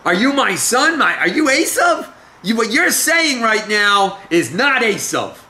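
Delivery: high, emphatic disbelief, acted as a doubting father; suspicious questioning. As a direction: challenge him in a high, incredulous voice. Acts out doubting Isaac testing son.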